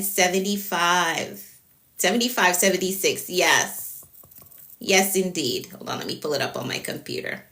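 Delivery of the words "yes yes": ordinary talk, nothing marked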